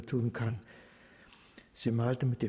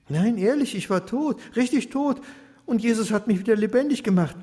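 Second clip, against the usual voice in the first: insistent; 7 semitones higher, 4 dB louder than usual. A narrator's reading. Baruch insists he was dead.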